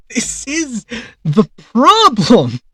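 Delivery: exasperated slow half-shout. Real, not acted. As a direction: blow up on the repeat. Repeats line, louder outburst.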